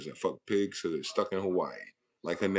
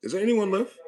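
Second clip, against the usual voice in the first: loud, high call to the room; 12 semitones higher, 5 dB louder than usual. Real, not acted. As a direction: shout out to see if anyone's still there. Calling out to silent chat.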